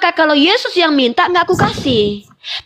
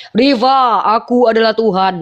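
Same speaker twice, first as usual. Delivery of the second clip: mock divine voice; 4 semitones lower than usual. Voices God introducing himself.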